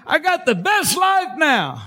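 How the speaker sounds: a touch of shouting praise, slow; lightly acted joyful cry; mock joyful testimony. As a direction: call it out like a happy believer. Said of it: "come up off the ground and".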